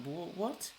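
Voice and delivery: high, rising stammered what; baffled disbelief. Acted. Accused person answers the group, baffled and high-pitched. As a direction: act taken aback, pitch climbing. Acted reaction to being accused.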